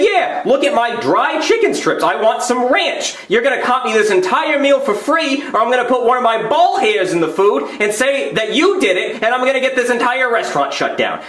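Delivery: high-pitched, indignant complaint; irate customer. Acted. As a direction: lay into the manager with escalating threats. Irate customer berating the manager.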